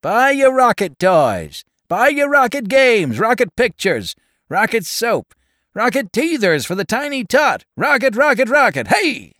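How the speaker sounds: loud, high-pitched street vendor patter; raucous hawker's cry. A narrator's reading. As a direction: sing out your wares to the crowd. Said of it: "raucous declarations of the vendors and hawkers".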